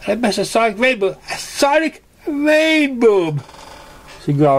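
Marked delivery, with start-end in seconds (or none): 0.1-1.2 high, fast griping pony voice; rushed complaint. Acted. Same words repeated. 1.3-3.4 very high drawn-out wail on rainbow; wailing repeat. Acted. Same words repeated.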